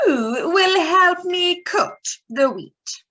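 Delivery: hen calling out. Acted. Hen asks in story.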